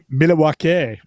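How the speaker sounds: "Milwaukee" drawn out as "Mil-a-wau-kee", extra syllable; mock pronunciation. Host jokes about place names, mock-saying "Milwaukee" as "Mil-a-wau-kee". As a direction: joke version of "Milwaukee" with a tacked-on vowel. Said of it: "It comes from the Indian".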